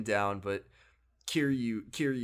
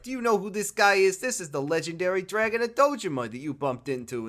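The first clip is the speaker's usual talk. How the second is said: high, loud thug bluster; mock tough-guy boast; hammed-up thug; 7 semitones higher, 4 dB louder than usual. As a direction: play the cocky henchman. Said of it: "He's like".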